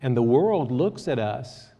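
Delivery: ordinary delivery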